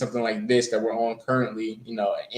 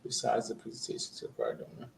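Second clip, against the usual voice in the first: very soft, quick, half-whispered repeat; thinking under your breath; muttering to self; 14 dB quieter, faster than usual. Real, not acted. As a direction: murmur the question back to yourself. Repeating the question to himself.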